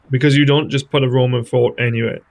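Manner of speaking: ordinary talk, nothing marked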